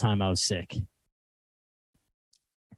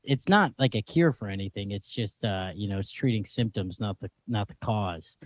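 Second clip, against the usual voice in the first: mumbling quietly; 6 semitones lower, 11 dB quieter than usual. Real, not acted. Trailing mumbled explanation.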